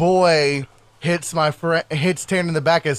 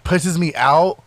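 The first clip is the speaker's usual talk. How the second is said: plain reading voice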